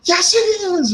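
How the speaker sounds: high-pitched, insistent comeback, retold; exasperated retort. Driver contradicts his nonchalant friend, exasperated retort. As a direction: throw it back high in mock exasperation.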